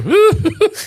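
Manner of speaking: high mock laugh, dad's style; imitated father's laugh. Son shows how dad laughed at his own story, imitated laugh. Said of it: "That's how he laughed".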